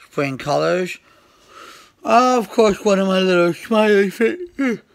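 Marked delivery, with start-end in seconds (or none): 2.0-4.8 words stretched through a big yawn, higher and louder. Real, not acted. Showing rocks to viewers, talking through a yawn. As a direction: talk right through a real yawn. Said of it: "I do apologize for the yawning".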